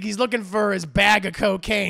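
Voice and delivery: usual speaking voice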